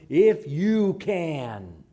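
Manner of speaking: "If you can" drawn out slowly and loudly; pointed rebuke. Acted. Jesus turning the father's words around, "If you can" as slow rebuke. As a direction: throw "If you can" back, stretching every word. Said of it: "turns it right back around".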